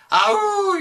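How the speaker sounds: sudden high yelp of pain; devil's scream. Acted. Devil crushed by the Pope, high yelp of pain. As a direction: yelp as if burned. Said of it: "The devil screams".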